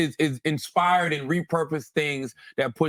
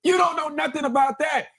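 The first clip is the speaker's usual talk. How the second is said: emphatic shout; 11 semitones higher than usual. Real, not acted. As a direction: shout it like a verdict. Emphatic repeat of lyric, shout.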